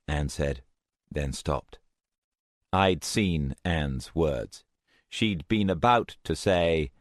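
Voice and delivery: ordinary delivery, nothing marked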